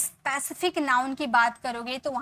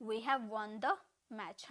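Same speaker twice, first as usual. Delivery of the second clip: slow teaching demo; 4 semitones lower, 14 dB quieter, much slower than usual. Shown as a demonstration. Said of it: "तो हम बोलेंगे ना".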